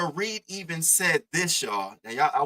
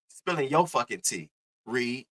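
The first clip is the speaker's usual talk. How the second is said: ordinary delivery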